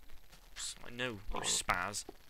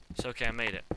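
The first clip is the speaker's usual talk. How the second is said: ordinary talk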